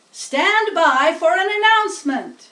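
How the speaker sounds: high, loud PA boom; booming loudspeaker. Acted. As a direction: fill the fairground with it.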